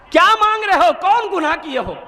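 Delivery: high-pitched, pressing questions; preacher acting an urgent interrogation; insistent questioning. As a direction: raise the pitch and demand an answer. Elder presses weeping young man.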